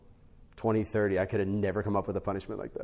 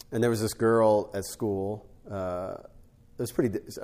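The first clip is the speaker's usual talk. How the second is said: ordinary delivery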